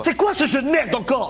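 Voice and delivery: loud, high angry outburst; raging gamer. Acted. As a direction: explode at the other game. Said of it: "Non, calme-toi".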